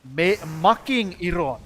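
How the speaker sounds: loud, slow, hammed-up Finnish accent. Shown as a demonstration. Streamer showing how Finns would say it, loud Finnish accent. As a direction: punch each word in a thick Finnish accent. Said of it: "That's how we would say it".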